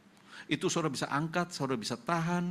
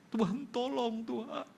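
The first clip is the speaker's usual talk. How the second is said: pleading prayer; 9 semitones higher than usual. Retold or imitated. Desperate prayer for sick wife.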